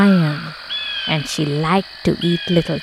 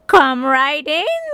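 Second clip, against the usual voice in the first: witch voice; 6 semitones higher, 3 dB louder, slower than usual. A narrator's reading. Witch invites her in.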